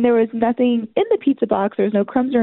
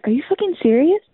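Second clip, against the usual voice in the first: rising mock disbelief; mock his catchphrase; mild imitation of the husband; imitating husband; 6 semitones higher than usual. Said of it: "you're like".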